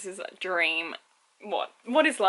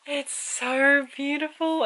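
slow gushing; 6 semitones higher, 4 dB louder, much slower than usual. Real, not acted. Gushing over the book.

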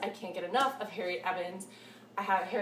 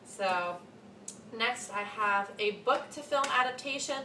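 normal talking voice

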